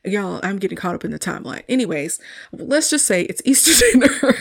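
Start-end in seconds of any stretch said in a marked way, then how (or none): 2.5-4.3 words broken by a real laugh. Real, not acted. Recapping her favorite scene, laughing mid-sentence. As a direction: laugh midway through the line.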